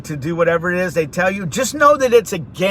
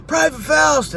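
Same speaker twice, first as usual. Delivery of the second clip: calling out; 8 semitones higher than usual. Retold or imitated. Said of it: "he's like".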